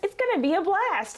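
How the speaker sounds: lightly voiced urgent warning; mock alarmed viewer. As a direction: plead with the team to see it. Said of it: "you're like".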